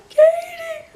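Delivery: very high imitated wail; hammed-up squealing sob; mock hysterical cry. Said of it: "freak out and cry together".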